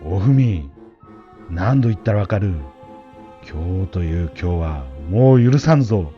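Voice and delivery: loud furious master's bellow; angry shouting. Acted. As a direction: roar at the servant girl. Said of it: "怒鳴った".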